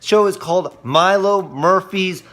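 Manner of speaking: ordinary delivery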